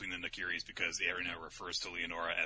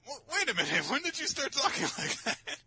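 high, loud incredulous squeak; bewildered; 5 dB louder than usual. Acted. Mother baffled by daughter's slang, incredulous. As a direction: burst out baffled, breaking into laughter. Acted bewildered reply to valley girl.

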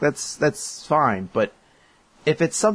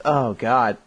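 normal talking voice

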